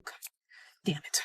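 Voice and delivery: hushed, breathy swearing under the breath; low whispered dismay; whispered curse. Retold or imitated.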